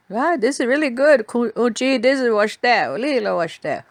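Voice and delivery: mock delight. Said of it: "but I'd be".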